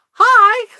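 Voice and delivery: high, loud chirpy hi; forced greeting. Retold or imitated. Cashier greets an angry customer with a high, loud hi. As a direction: greet brightly despite dread.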